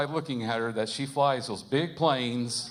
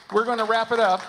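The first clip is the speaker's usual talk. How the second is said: calling out; 10 semitones higher, 10 dB louder than usual. Real, not acted. Host calling the crowd to wrap up.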